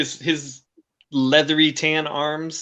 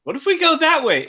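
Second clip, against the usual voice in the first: put-on high, pleading little kid voice; a small kid begging dad; child voice; 11 semitones higher than usual. Kid asking dad again.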